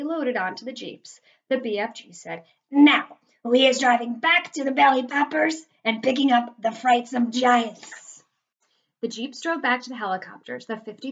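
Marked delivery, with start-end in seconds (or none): 2.7-8.2 loud, higher giant voice, drawn out at the end; giant's character voice. A narrator's reading. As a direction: announce the plan loudly as the giant. Narrator voices the giant giving orders.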